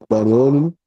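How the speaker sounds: distorted, crackly line sound; distorted audio. Real, not acted. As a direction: voice through a distorted connection. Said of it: "You sound a little bit distorted".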